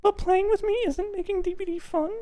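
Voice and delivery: high put-on girly voice; hammed-up girlfriend voice. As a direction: play the hurt girlfriend, way up high. Reading Jen's line in put-on voice.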